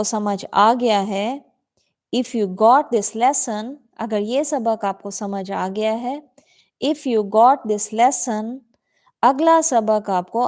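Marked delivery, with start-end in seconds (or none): 2.2-3.7 drawn-out, careful phrasing; slow model reading. Shown as a demonstration. Teacher models the sentence slowly for the class. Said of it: "teacher models the sentence". 6.9-8.6 drawn-out, careful phrasing; slow model reading. Shown as a demonstration. Teacher models the sentence slowly for the class. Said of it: "teacher repeats the model".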